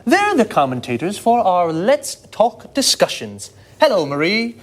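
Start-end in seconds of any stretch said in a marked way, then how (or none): none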